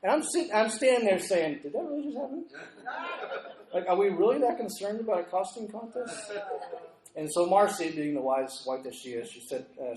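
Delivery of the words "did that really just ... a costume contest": high-pitched mock incredulity; incredulous question in a raised pitch; acted disbelief. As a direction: play up your baffled disbelief. Acts out his disbelieving thought.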